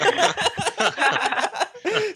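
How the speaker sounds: long high-pitched laugh; high laughter. Real, not acted. Storyteller cracking up at own punchline, high laughter. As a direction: burst out laughing.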